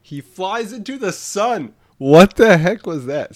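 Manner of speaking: high-pitched incredulous yell; screamed outburst. Real, not acted. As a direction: scream it into the mic in disbelief. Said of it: "screamed into my mic".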